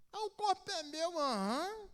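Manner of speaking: high, sneering mock voice; mocking people who say their body is theirs, high and loud. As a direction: parody the smug objector.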